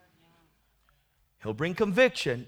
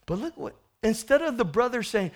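ordinary talk, nothing marked

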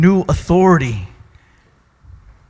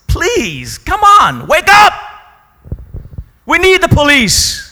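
loud pleading; 10 semitones higher, 4 dB louder, slower than usual. Acted. Pleading with society for police.

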